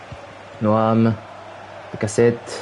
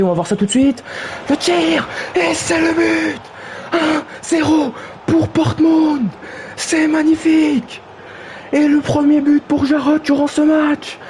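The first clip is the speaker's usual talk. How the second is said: goal celebration; 12 semitones higher than usual. Acted. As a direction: hold every word up there in triumph. Goal scored, commentator celebrates.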